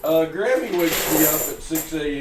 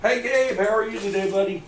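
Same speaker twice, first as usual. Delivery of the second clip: bright, louder and higher hello to a viewer; excited greeting; 6 semitones higher, 4 dB louder than usual. Real, not acted. Host cheerfully greeting Gabe on the stream.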